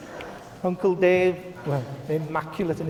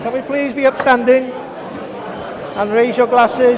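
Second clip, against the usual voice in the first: loud, higher call to stand; wedding toast speech; 7 semitones higher, 9 dB louder than usual. Real, not acted. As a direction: project to the whole room. Repeats request louder to guests.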